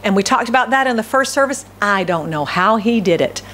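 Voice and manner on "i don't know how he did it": normal talking voice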